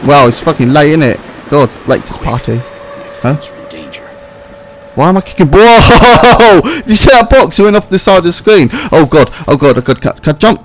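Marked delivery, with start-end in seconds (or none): 5.5-6.9 high, loud startled whoa; sudden surprised shout. Real, not acted. Startled by a kick.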